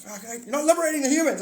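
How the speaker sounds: high, loud angry alien shout; alien argument. Pretend. Alien yelling at the rival alien, loud and high. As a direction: yell him down, furious and high.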